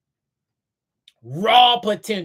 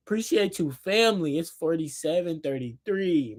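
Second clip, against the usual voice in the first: ordinary delivery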